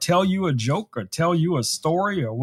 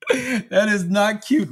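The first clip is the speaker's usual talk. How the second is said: higher, slow mock-stern scold; 5 semitones higher, slower than usual. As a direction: scold him in a higher, drawn-out voice.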